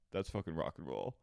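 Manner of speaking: whispered aside; hushed. Retold or imitated. Kristen whispering in the truck, hushed. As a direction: breathe it out low and secret.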